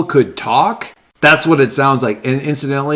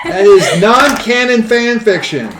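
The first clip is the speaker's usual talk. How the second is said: high, loud laughing protest; 10 semitones higher, 4 dB louder, slower than usual. Real, not acted. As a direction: shriek it out in mock outrage. Mock outrage at fanfic joke.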